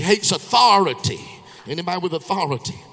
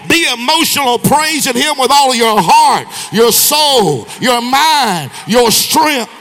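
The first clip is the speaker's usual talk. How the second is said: much higher and louder, shouted sermon climb; preaching at full force; 8 semitones higher, 10 dB louder than usual. Acted. Preacher rebuking emotional churchgoers, preaching at full force. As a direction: hammer it home to the church.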